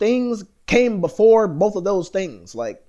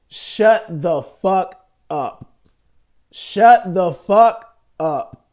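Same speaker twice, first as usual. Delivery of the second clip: slow furious command; 6 dB louder, much slower than usual. Real, not acted. Ranting at critics, slowed.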